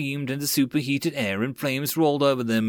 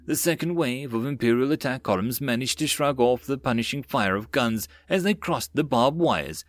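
plain reading voice

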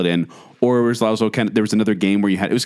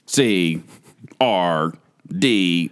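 lower, lightly robotic letters, one by one; robotic toy voice; 4 semitones lower, much slower than usual. Retold or imitated. Co-host demonstrating the toy's letter sounds, lightly robotic. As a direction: imitate a toy reading out letters. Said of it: "that's how it sounded".

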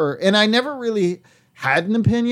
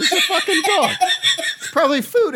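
laughing; 10 semitones higher than usual. Real, not acted. Laughing explanation.

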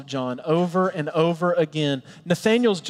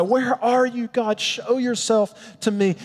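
crying out to God; 6 semitones higher, slower than usual. Retold or imitated. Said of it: "We cry".

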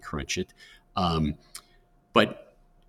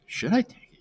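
mock-anxious, much higher-pitched question; imitating scared student. Advisor mimics student facing a job offer, mock fearful. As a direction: squeak it like a nervous student. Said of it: "you can see the fear of God in their eyes".

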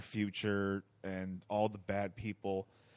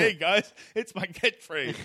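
high, loud, slow hammy announcer; mock self-promoter; 10 semitones higher, 8 dB louder than usual. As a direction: oversell it like a cringey self-promoter. Mocking self-promoting catchphrase.

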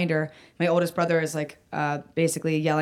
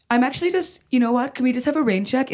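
high-pitched, tentative retold excuse; politely wriggle out, voice pitched up; nervous backing out; 6 semitones higher than usual. Child backing away from a scary mother, mock-nervous excuse. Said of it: "started getting so scary".

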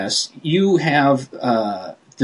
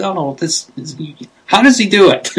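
mock amazement; 6 semitones higher than usual. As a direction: play a fan floored by how much he does. Voicing an awed fan.

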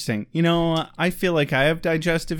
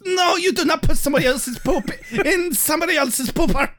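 shouted mock German; 12 semitones higher than usual. Correcting a friend in a yelled German accent. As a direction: bark it in a cartoon German voice. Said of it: "Isn't that where it goes".

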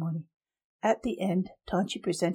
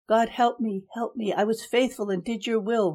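higher and louder, a desperate plea; frantic begging, pitched up and loud; scream for help; 4 semitones higher, 4 dB louder than usual. A narrator's reading. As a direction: cry out to God as the guards drag him off. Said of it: "Reimer screamed".

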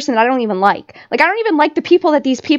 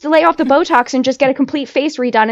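normal talking voice

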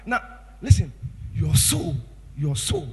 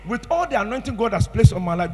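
usual speaking voice

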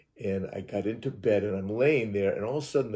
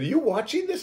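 much higher, thrilled ask; amazed question; 3 dB louder than usual. Retold or imitated. Asking Terry about the flashes, amazed high voice. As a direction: burst out in wonder. Excited seeing flashing lights.

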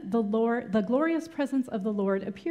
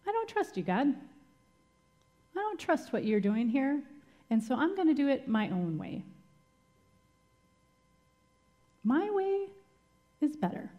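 defiant; 6 semitones higher than usual. Retold or imitated. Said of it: "Moses was basically saying".

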